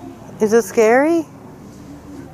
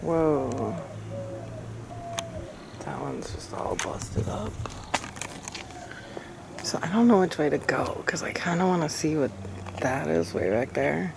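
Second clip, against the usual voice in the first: whisper; 7 semitones lower, 15 dB quieter than usual. Real, not acted. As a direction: whisper so nothing hears. Whispering alone among graves.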